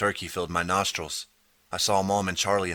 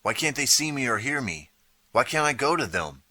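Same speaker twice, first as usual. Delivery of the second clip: pleading; 6 semitones higher than usual. A narrator's reading. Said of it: "I cried".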